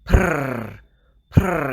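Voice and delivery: trilled purr; imitated cat purr. Retelling what the cat kept doing, trilled purr. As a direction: roll the sound like a purring cat. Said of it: "the cat kept".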